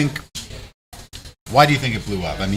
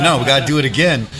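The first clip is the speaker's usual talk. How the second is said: loud, higher retold order; comic insisting, louder and pitched up; mock insistence; 6 semitones higher, 8 dB louder than usual. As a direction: push the point in a raised voice. Acted insisting to wife on shoveling.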